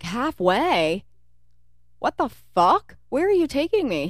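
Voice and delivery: high, loud disbelief; hammed-up alarmed yelling; shocked retelling. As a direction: react like you've been kidnapped.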